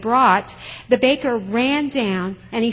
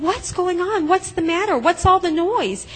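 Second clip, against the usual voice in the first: high, urgent calling out; alarmed questions; 8 semitones higher, 3 dB louder than usual. Acted. Baker calls out to the townspeople, high and alarmed. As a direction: ask the crowd what's wrong, worried.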